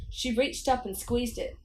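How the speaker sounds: plain reading voice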